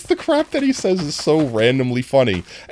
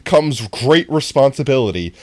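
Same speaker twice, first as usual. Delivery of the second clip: usual speaking voice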